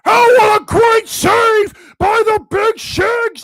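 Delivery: big, slow booming arena announcer; PA announcer call. Pretend. Imagined PA guy hyping the save to a silent arena, slow booming shout. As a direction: belt it out like the rink PA guy. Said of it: "I didn't hear a P A guy saying".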